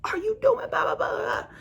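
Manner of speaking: high squeaky mock of a panicked man; mocking his panic. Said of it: "He like".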